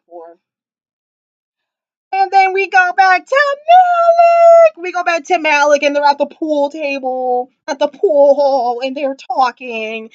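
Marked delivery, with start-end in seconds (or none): 2.1-10.1 dramatic narration. Real, not acted. Dramatic storytelling build.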